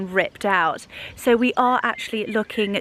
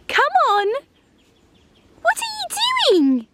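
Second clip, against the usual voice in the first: dog voice; faster than usual. Real, not acted. Owner talking to her dachshund in high dog voice. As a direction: coo at the dog in a silly high voice. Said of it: "I am so sorry for my dog voice".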